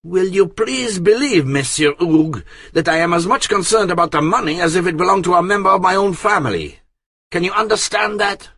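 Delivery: high, loud, fast indignant retort; high and mighty. A narrator's reading. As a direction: put the old man firmly in his place. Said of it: "took a high tone with him".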